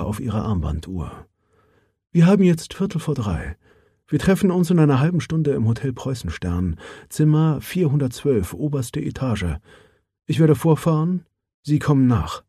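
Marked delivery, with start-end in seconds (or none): 2.1-12.4 lightly voiced businesslike woman; a touch higher, cool and matter-of-fact; Mara Johansson. Mara gives instructions. (sachliche Frauenstimme, leicht angehoben)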